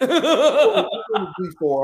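high, loud laughing G four; laughing disbelief. Real, not acted. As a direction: laugh it out, amazed at the private jet. Amazed retelling of the jet.

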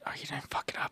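whispered mock reaction; soft, voiceless aside; hushed whisper. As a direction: whisper it under your breath. Mock-impressed reaction to host reading dates.

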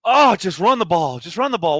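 hammed-up fan outrage; mock frustrated shout. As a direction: yell it at the TV like a fed-up fan. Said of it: "and I'm like".

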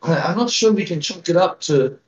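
put-on frail old village elder, worried; a hint of a tiny old lady pleading; very old woman. GM voicing elder Grilda.

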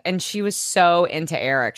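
ordinary talk

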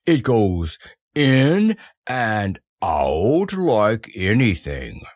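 very slow, drawn-out donkey voice; slow, heavy satisfaction; satisfied Eeyore. Acted. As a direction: savour each word at a donkey's plod. Eeyore proudly demonstrates his gift.

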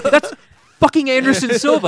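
loud, very high exclamation; starstruck insistence. Retold or imitated. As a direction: hammer home who this legend is. Retold starstruck outburst at coworkers.

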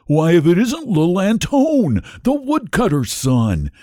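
delighted-sounding surprise at who it is; exclaiming. A narrator's reading. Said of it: "exclaimed the very stout cabbage".

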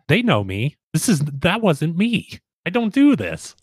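mock protest rising high; Kramer. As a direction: imitate a flustered apology, climbing in pitch. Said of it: "Kramer kept saying stuff like".

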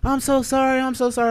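high-pitched mock-remorseful lady; over-the-top apologetic impression; gushing apology imitation. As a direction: plead in a put-on high woman's voice.

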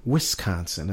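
"Wisconsin" repeated softly as WIS-consin; corrected local pronunciation. Shown as a demonstration. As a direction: practise "Wisconsin" under your breath, with a short-i WIS. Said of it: "Twitter bud [name] to say".

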